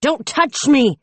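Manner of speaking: high loud snap to let go; furious shout. A narrator's reading. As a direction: shout him off you. Struggling against the officer.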